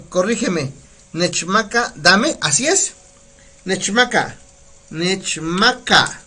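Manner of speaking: ordinary delivery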